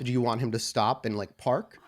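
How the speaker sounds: ordinary delivery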